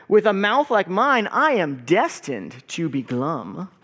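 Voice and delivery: put-on lively picture-book read-aloud for kids; story time reading. A narrator's reading. Pastor reading a picture book to the congregation, lively read-aloud.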